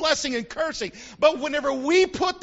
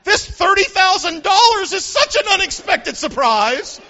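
higher, louder thrilled exclamation; upped excitement; 6 semitones higher, 5 dB louder than usual. Acted. Preacher upping it for a few of you, excited. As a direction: gush over an unexpected windfall. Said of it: "I'm gonna have to up it".

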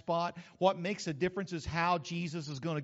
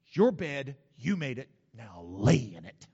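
harsh saying; 4 semitones lower, 8 dB louder than usual. Retold or imitated.

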